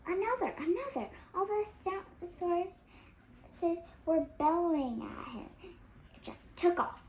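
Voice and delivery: fast, rising panic; excited. A narrator's reading. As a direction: build the danger. Escalating danger narration.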